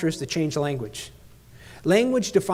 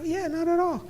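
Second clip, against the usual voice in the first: high-pitched assurance; 11 semitones higher, 5 dB quieter than usual. Retold or imitated. Retold dad reassures amazed daughter.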